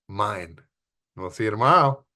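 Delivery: ordinary talk, nothing marked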